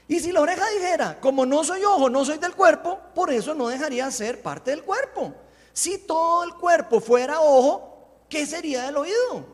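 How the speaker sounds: high, loud proclaimed verse; scripture reading. Real, not acted. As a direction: read it out forcefully to the congregation. Reads scripture aloud. (lectura bíblica enérgica, en voz alta)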